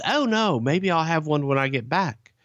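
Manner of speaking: high, untroubled English gentleman voice; lilting high put-on reply; Alfred impression. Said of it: "He says".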